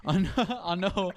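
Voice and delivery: laughing it off after a voice crack; embarrassed laugh through the words. Real, not acted.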